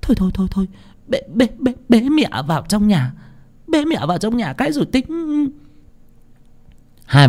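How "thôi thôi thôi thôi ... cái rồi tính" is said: high, urgent, stuttered repeats; flustered panic. A narrator's reading. Wife urging husband to carry mother inside, flustered. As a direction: hurry him along in a high panic. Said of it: "hốt hoảng xua tay".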